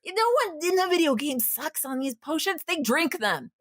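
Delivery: worked up, pitch climbing high; agitated. Real, not acted. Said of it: "I'm getting worked up right now".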